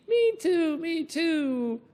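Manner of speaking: high parrot chirp. Acted. Parrot agreeing with the children, high chirp.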